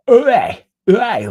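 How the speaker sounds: loud, high Leicester "Oi, oi" yelled at the cats; aggressive shout at cats. Retold or imitated. Imitating a woman yelling at cats, loud and high. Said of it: "when she's talking to cats aggressively".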